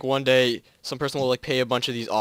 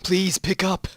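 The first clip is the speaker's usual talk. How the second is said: pleading; 7 semitones higher, 3 dB louder, much slower than usual. Acted. Desperate radio plea.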